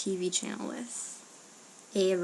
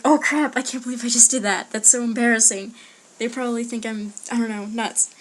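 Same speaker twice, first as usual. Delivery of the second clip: higher, much louder at the start, easing off; retold mortified outburst; 4 semitones higher, 9 dB louder than usual. Vlogger retelling an accidental accent slip to listeners, mortified outburst. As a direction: burst out in mock mortification.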